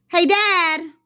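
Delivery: high, loud call to dad; shouted. Acted. Child shouting up to his father. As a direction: shout up to him. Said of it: "he shouted".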